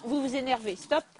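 ordinary delivery

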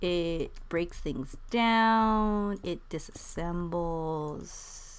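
very slow, stretched-out words; drawn-out explaining. Real, not acted. Player explaining the disassembler to an exasperated friend, drawn out. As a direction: spell out the obvious, dragging each phrase. Spelling out the obvious, teasingly.

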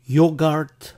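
"yogurt" as YO-gart, with an ah vowel; wrong quiz option. Shown as a demonstration. Teacher offers a decoy choice, "yogurt" as yo-gart. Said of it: "Escoge la correcta".

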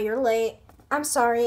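ordinary talk

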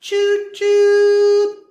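loud high train whistle choo-choo. Pretend. Train sound.

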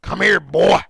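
loud imitation of a sound alert; mimicking an alert clip. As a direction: parrot the clip loudly.

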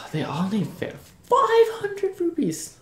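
high, slow, sing-song mock fairy; exaggerated fairy voice. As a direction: stretch it out in a silly high voice. Groans at the fairy's price.

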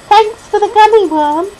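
put-on high, loud small-boy voice; little boy's voice. A narrator's reading. Boy thanks girl for gummy worm, little boy's voice.

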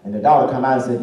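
ordinary talk

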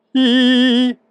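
a high, loud, tense i. Shown as a demonstration. Doctor shows a high tone on camera, high tight i. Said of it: "Om jag tar en hög ton".

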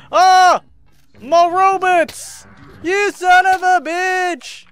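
high, loud, drawn-out wail; anguished yelling. Real, not acted. Player crying out over killed robots, anguished drawn-out yell. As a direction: wail slowly at the top of your voice.

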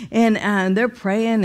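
ordinary talk

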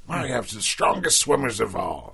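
a hint of a boastful doctor. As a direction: brag it lightly in character.

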